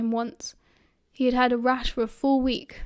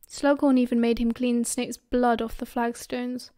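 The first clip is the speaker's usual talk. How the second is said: usual speaking voice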